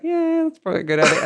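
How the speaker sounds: mock agreeing. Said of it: "And they're like".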